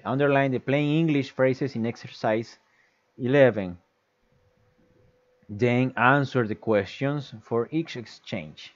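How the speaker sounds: slow, careful textbook reading; measured read-aloud of an English instruction; reading the exercise aloud. Shown as a demonstration. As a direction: read each English word out clearly for the class.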